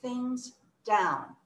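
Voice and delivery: drawn-out words with gaps; slowed down. Shown as a demonstration. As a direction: take "slow things down" word by word. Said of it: "then we can slow things down".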